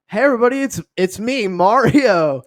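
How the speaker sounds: loud, high, hearty audition announcement; Chris Pratt. Acted. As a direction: belt out the character's name cheerfully. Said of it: "like, Chris Pratt's like".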